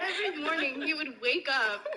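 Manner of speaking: put-on British accent; lightly done British voice, laughing. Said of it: "Can I do an impression?".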